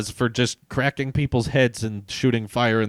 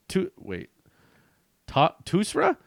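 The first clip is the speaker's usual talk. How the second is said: ordinary talk, nothing marked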